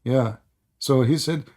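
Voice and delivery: ordinary talk, nothing marked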